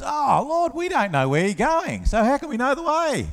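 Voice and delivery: a hint of an anxious disciple; questioning Thomas. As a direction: ask the Lord earnestly for the way. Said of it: "He says".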